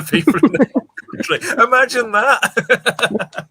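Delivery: a hammed-up posh gasp, slow and high; slow, high mock-posh aside. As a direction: play the snooty Brummie toff, slow. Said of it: "Birmingham Police are so posh".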